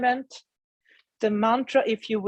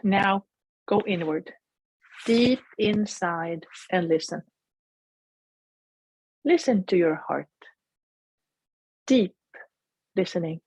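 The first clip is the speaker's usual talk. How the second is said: guided meditation. Acted. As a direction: gently guide them inward.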